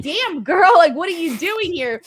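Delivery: playfully shocked, calling out the wrestler; mock shocked reaction. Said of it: "And I'm like".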